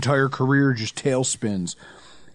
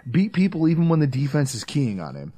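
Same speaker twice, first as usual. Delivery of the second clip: ordinary delivery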